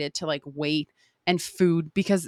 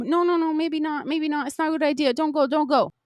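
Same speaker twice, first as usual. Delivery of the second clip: higher, anxious pleading no no no; scared inner voice; 7 semitones higher than usual. Retold or imitated. Her scared past self holding her back, anxious high pleading. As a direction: voice your frightened past self begging you to stay. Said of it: "the same".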